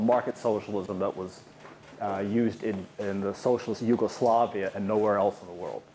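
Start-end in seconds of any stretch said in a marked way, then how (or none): none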